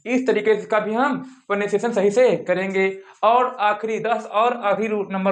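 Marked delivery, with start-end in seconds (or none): none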